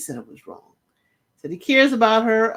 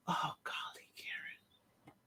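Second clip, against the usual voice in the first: breathy whispered aside, much softer; exasperated whisper; 4 semitones lower, 21 dB quieter, slower than usual. Real, not acted. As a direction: whisper it in disbelief at Karen.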